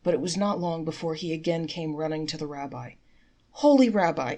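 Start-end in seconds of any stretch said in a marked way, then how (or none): 3.5-4.3 high, loud wailing plea. Acted. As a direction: wail to the rabbi for help. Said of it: "he wailed".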